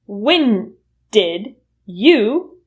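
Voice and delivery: When did you spelled out slowly; slow full form. Shown as a demonstration. Teacher showing the full form slowly. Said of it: "will reduce to whenja".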